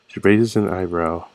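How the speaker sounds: normal talking voice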